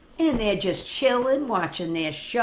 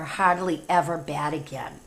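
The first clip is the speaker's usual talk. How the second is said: usual speaking voice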